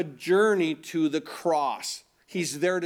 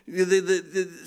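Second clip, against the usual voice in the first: normal talking voice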